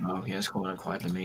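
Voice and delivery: low, soft, proper English gentleman; Sherlock Holmes voice. Acted. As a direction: play the polite English butler, hushed. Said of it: "started talking like this".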